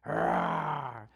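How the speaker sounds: drawn-out imitated monster roar; loud Frankenstein "rawr"; monster growl. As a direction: growl like a monster under the bed. Imagines Frankenstein under the bed.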